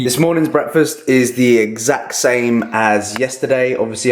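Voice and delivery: ordinary talk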